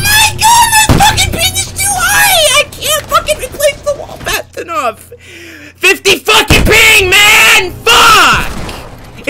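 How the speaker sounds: screaming, high-pitched gamer rage; raging gamer. Real, not acted. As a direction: yell it out in full fury. Gamer screaming about high ping.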